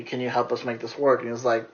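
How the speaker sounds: ordinary talk, nothing marked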